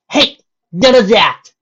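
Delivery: loud, high protest; yelling. Acted. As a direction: shout her down. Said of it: "Dash yelled".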